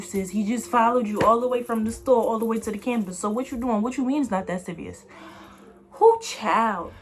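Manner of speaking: normal talking voice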